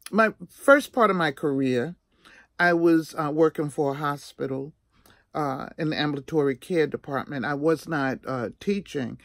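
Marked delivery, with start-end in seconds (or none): none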